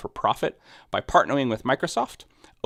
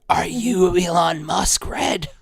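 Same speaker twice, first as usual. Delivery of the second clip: mock shocked gasp; 8 semitones higher, 5 dB louder, much slower than usual. Mock accusation after gasp.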